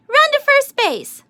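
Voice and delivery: very high, loud urgent yell. A narrator's reading. Boys yelling at Amelia Bedelia to run, very high and loud. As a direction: holler her on to run. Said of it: "yelled the boys".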